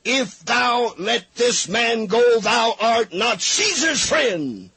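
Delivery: slow, high, loud crowd cry. Acted. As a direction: drag it out like a mob yelling.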